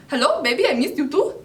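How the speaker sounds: high, swooping sing-song caller voice; imitated caller. Girl imitating the man calling from India, put-on voice. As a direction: mimic a lovey stranger on the phone. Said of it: "I just hear this voice".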